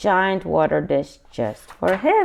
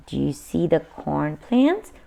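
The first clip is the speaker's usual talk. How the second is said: ordinary delivery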